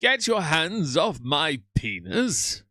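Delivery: slow, loud mock outrage in a put-on voice; quoted outburst. Repeating a famous quote to chat, slow mock outrage. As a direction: ham up the quote as an indignant shout. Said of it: "The quote is glorious".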